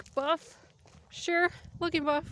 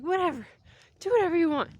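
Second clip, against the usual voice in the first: annoyed mom; 6 semitones higher, 7 dB louder, faster than usual. Retold or imitated. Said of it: "She's gonna get mad".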